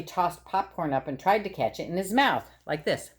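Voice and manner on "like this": usual speaking voice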